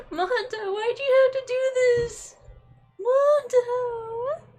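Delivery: high, loud anguished wail; mock-sobbing over a dead favorite; wailing cry. Real, not acted. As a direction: wail his name in grief. Grief over dead character, wailing.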